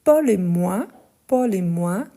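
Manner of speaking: normal talking voice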